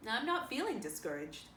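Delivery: dry, unbothered reply; flat and firm, a touch dry. A narrator's reading. Marilla answers Rachel's doubts, dry. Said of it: "was Marilla's dry response".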